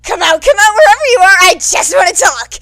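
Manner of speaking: high, booming taunting yell; hollering threat through the hallway; loud hollering. Acted. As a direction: bellow it like a stadium announcer. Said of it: "there goes President Mike".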